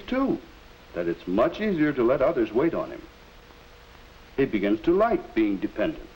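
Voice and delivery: measured, earnest educational film voiceover; 1950s narrator. A narrator's reading. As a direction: narrate like an old classroom film.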